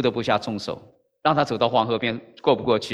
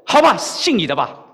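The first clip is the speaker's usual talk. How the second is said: through gritted teeth; 5 semitones higher, 6 dB louder than usual. Retold or imitated. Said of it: "咬牙切齿说".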